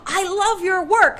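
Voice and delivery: high, loud, slow admiring voice; a woman swooning over the artwork, high and slow; gushing stranger. Retold or imitated. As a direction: gush over the painting.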